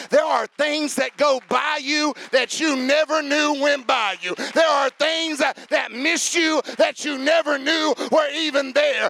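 loud, high, ringing preacher's shout; full-force preaching. Acted. Pastor preaching loud to the church about God's protection. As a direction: preach it out big to the congregation.

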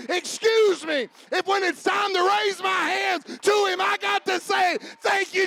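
loud, high, driving sermon delivery; full-force preaching. Acted. Pastor exhorting the church, preaching at full force. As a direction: preach it out over the congregation.

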